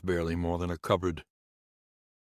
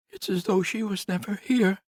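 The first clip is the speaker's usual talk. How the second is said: much higher, louder woman's voice, bitter; grieving nurse; 5 dB louder than usual. Acted. Nurse complains to Conrad about the house, higher voice. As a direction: lift into the nurse's aggrieved voice.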